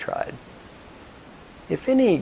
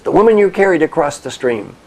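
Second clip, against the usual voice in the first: loud, high exasperated shout; acted indignation, voice raised; angry monk; 6 semitones higher, 10 dB louder than usual. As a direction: yell it as an outraged reminder. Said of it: "The angry monk says".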